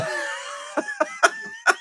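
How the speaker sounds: very high wheezing laugh. Real, not acted. Host amused by a silly movie title, wheezy laugh.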